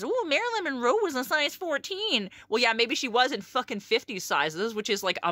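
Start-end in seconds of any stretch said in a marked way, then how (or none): 0.0-2.3 lightly voiced gossip; imitating everyone repeating the Marilyn Monroe fact, lightly; mock know-it-all. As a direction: parrot the common claim with a knowing "Ooh". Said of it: "everyone says".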